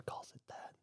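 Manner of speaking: voiceless whispered aside. Real, not acted. A teen mutters to the group, whispered. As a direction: whisper it under your breath, half to yourself.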